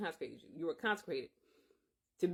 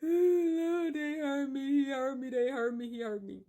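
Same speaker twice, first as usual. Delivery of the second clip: imitated crying prayer; 6 semitones higher than usual. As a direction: replay the sobbing plea to God, starting high and dying away. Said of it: "I was just crying like".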